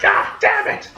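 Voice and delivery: high-pitched mock yelp of pain; acted, strained outburst; pained cursing. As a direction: curse like you just hurt your foot. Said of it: "that must have hurt".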